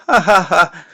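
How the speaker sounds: high, loud fake ha ha ha; forced fake laugh. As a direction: laugh falsely to cover up. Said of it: "forcing a fake laugh".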